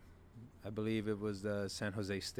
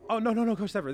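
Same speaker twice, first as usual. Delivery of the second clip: flustered backpedal; 12 semitones higher, 8 dB louder, faster than usual. Retold or imitated. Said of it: "I got a little excited".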